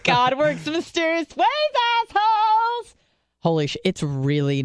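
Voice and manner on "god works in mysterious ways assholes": very high-pitched taunting crow; playful squealed gloating; mock-triumphant gloat. Gloating over last year's tweet.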